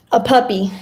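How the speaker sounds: ordinary delivery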